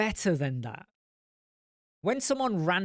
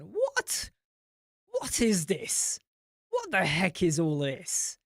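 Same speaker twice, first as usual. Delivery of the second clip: incredulous; 2 semitones higher, slower than usual. Real, not acted. Reacting to a viewer's accent comment, incredulous. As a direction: sound baffled and amused.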